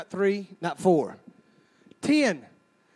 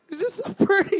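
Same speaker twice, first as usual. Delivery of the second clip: mock weepy lady; 6 semitones higher than usual. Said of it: "y'all start crying at that point".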